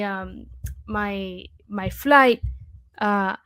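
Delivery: ordinary talk, nothing marked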